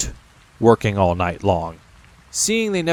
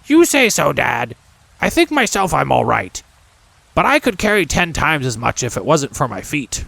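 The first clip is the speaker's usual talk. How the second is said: put-on young goblin son; 4 semitones higher than usual. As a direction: whine a little about the heavy chest. Goblin son Helfer boasts.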